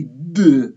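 D pushed out hard as a heavy, drawn-out "duh"; overstressed Dalet. Shown as a demonstration. Teacher showing students an overdone final letter, D heavily overemphasized. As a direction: overdo the letter D with a vowel after it. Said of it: "Margish the Dalet too much".

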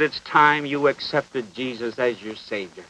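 low, slow, hushed retelling; retold whisper. Preacher retelling his words to a dying old man, hushed. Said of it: "leaned over and whispered in his ear".

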